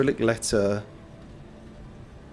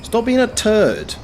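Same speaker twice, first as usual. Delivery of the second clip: drawn-out scolding; 7 semitones higher, slower than usual. Real, not acted. Scolding game enemies.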